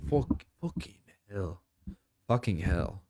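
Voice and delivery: mock British swear, lightly done; British accent. Streamer mimicking British swearing, light British accent. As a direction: say it like a Brit, quietly. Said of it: "as the British would say".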